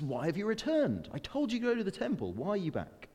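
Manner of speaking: high, quick, indignant questions; incredulous questioning. Acted. King to his returned messengers, incredulous. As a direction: demand answers, pitch leaping up.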